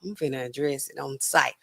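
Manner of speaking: low, quiet mock warning; low muttered retort. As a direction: say it low and under the volume. Acting out her confrontational retort.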